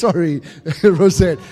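giggling through sorry; real embarrassed laugh; laughing apology. Real, not acted. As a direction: laugh at your own slip. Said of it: "I just got tongue-tied then".

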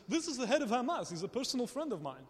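lilting imitation of a Dutch accent; playful, mild Dutch accent. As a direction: lightly voice a proud Dutchman. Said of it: "That was my Dutch accent".

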